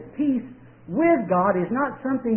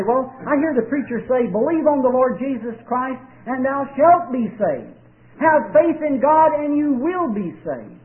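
imitating a doubter, higher and louder; voicing a puzzled listener; mock doubter; 6 semitones higher, 3 dB louder than usual. Preacher voicing a doubter who quotes sermons. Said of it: "They say".

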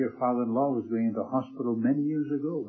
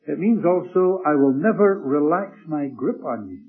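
preaching emphasis; 3 semitones higher, 8 dB louder than usual. Acted.

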